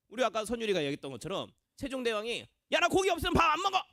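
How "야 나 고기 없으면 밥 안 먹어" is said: high, quick picky-eater whine; food tantrum. Acted. King Sejong fussing over side dishes, high tantrum. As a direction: throw a fast, high tantrum at the table. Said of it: "반찬 투정하는 사람".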